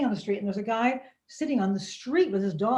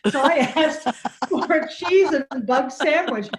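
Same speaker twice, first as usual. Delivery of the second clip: laughing; 5 semitones higher, 6 dB louder than usual. Real, not acted. Laughing at own mistake.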